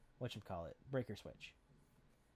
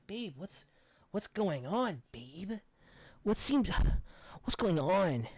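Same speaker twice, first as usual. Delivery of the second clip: lightly voiced boyfriend, higher pitch; imitated boyfriend; 6 semitones higher than usual. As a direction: call out to babe as a mock puzzled boyfriend. Acting out the returning boyfriend calling.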